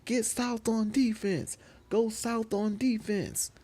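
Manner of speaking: very high, stretched-out teammate call; high sing-out coaching parody; mock court call. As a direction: call the team back, high and drawn out. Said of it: "instead of saying".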